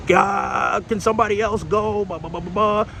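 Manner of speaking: hammed-up coworker complaining, trailing into blah; imitating a coworker crashing out; mock meltdown. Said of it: "started flipping out, crashing out".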